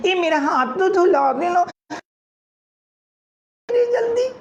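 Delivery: very high squeal; squeaky hurried plea; panicked squeak. Acted. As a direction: rush a friend in alarm. Girl panics as boys arrive.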